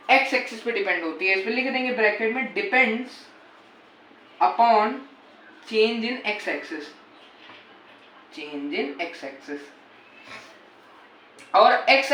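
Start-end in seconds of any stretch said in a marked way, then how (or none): 2.5-6.8 slow, word by word dictation; dictation pace. Shown as a demonstration. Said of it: "dictating to students". 8.4-9.6 slower and much softer repeat; fading dictation. Shown as a demonstration. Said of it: "dictating to students".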